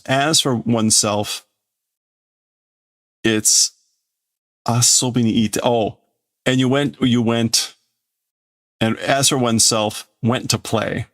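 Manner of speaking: plain reading voice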